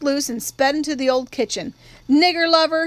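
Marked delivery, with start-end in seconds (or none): 2.1-2.9 yelled taunt. A narrator's reading. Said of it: "he yelled".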